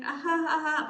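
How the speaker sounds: short dry polite chuckle; a forced little laugh for someone they don't enjoy; flat, obligatory ha ha. Retold or imitated. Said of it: "they don't laugh like that".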